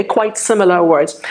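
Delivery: normal talking voice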